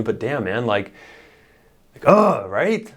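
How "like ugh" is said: sudden loud high grunt; guttural grunt. Real, not acted. Coach grunting to show his listeners masculine drive. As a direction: grunt out the raw masculine energy. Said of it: "get a little crude on you".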